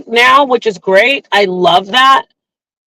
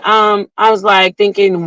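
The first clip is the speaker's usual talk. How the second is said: usual speaking voice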